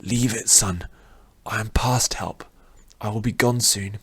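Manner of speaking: faint, whispered dying voice; weak voice. Acted. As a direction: barely get the words out. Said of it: "his voice was still weak".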